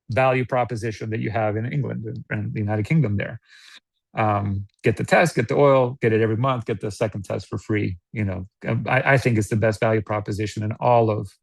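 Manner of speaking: normal talking voice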